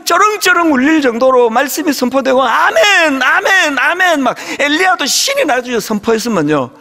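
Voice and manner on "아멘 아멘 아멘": fervent amens. Retold or imitated. Imitating the crowd answering the pastor with fired-up amens. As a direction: call out amen like a fired-up revival crowd. Congregation shouting amen in revival.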